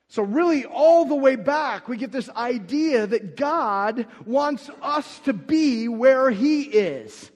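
full-force preaching. Acted. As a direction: preach it out to the whole room.

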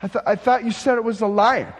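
can't square what he sees; puzzled questioner. Acted.

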